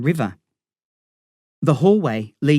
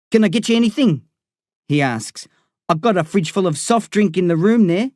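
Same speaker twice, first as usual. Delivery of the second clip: Darren, cocky gangster; 6 semitones higher than usual. Acted. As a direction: rib your old mate with swagger.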